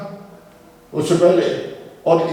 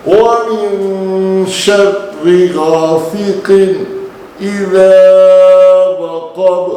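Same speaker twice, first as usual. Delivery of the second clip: slow, drawn-out louder recitation; Quranic recitation; 7 dB louder, much slower than usual. Shown as a demonstration. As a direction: recite it slowly, full and heavy. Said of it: "काफ का ख्याल रखेंगे". (धीमी, ज़ोरदार क़िराअत)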